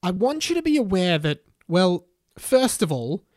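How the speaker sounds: loud, deliberate address to a hall; single out students one by one, emphatically; slow, declamatory school announcement; Harry Potter's speech. A narrator's reading.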